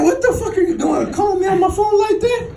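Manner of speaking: high-pitched indignant outburst; mock outrage at a prank caller; irate boss. Boss angry at drunk call.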